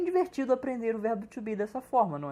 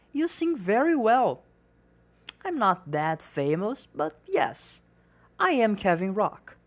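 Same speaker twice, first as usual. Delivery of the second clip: clear, slightly louder reading of both parts; dialogue read for learners; 6 dB louder, slower than usual. Acted. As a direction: read the dialogue aloud as a model for students. (leitura de diálogo para alunos)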